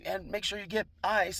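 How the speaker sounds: high-pitched mock yell; retold yelling. Woman calling after him as he drives away, mock yell. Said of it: "she's yelling at me".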